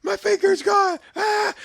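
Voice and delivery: high, panicked mock scream; retold scream. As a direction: shriek it as a panicking kid. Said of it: "And I screamed".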